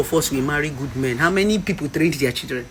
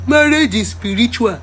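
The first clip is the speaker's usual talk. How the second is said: raised, louder mock-preachy quote; 6 semitones higher, 4 dB louder than usual. Woman mimics what they tell struggling wives, mocking. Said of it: "That is where they tell you".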